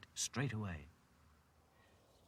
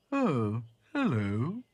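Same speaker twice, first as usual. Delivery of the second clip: loud, higher creature voice; mildly surprised giraffe; 3 semitones higher, 7 dB louder, much slower than usual. Acted. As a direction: greet them with mild surprise. Giraffe greets dolls.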